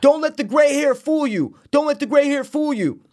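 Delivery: high, hammed-up imitation of a threat; blustering old man. Older golfer warning the younger men, blustering.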